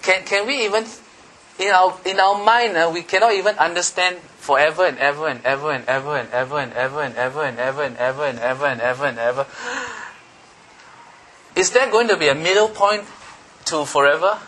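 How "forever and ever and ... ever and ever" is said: fast breathless repetition of ever; rattling on. Shown as a demonstration. Preacher shows forever, fast and breathless. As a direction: rush the and evers together. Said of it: "I need to catch my breath".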